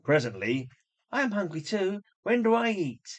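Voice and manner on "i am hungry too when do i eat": higher, whiny little plea; plaintive girl voice. Acted. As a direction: beg for food like a neglected girl. Lois voicing put-upon Cinderella.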